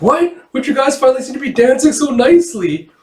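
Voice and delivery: very high, hammed-up disbelief; mock dismay. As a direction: protest in a high, startled acted voice. Acting dismayed onlooker at squabble.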